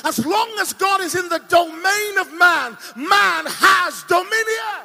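loud pulpit proclamation; fiery preaching. Acted. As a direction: hammer the point home to the church. Preaching at full force.

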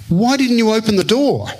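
high-pitched retold challenge; incredulous question. Host questions the preacher about his dream, mock reproachful. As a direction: put the question to him, pitched well up.

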